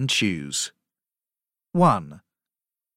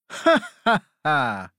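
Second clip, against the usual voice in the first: mock laugh; 3 semitones higher, 3 dB louder than usual. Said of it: "The cat and the dog laugh. They say".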